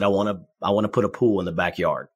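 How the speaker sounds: ordinary talk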